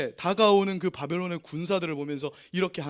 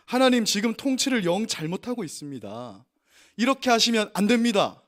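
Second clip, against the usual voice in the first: mock protest prayer; 5 semitones higher, 5 dB louder than usual. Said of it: "이렇게 하나님 앞에 기도하는 거예요".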